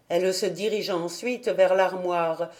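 plain reading voice

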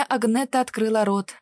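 usual speaking voice

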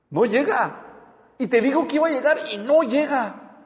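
high-pitched, indignant outburst. Acted. Wife whose husband never came for dinner, indignant. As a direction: repeat it in mock outrage at being stood up. Acts wife's reaction to no-show.

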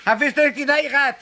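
high loud indignant outburst; indignant accusation; angry complaint. Acted. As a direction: scold him for blabbing. Angry accusation over card game.

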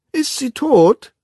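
put-on very high, slow nagging child voice; curious child. A narrator's reading. The curious twin trots beside the Savage, in a nagging child's voice. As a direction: repeat it like a pestering little kid. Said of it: "wiederholte der neugierige Zwilling".

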